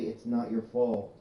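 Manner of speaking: slow movie quote. Retold or imitated. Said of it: "they just say".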